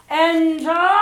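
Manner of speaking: deep, dangerous bark, very loud; booming command. A narrator's reading. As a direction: boom it like a fearsome headmistress. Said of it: "boomed the deep and dangerous voice".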